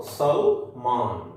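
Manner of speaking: सलमान drawn out very slowly, ल and न half; slow, clear सलमान as the final answer; slow final model. Shown as a demonstration. Teacher slowly sums up how सलमान is spoken. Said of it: "अब ये सलमान इतना रह गया".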